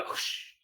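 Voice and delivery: soft unvoiced shush; breathy hush to silence a talker; whispered shush. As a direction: hush her quietly. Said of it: "मैंने बोला".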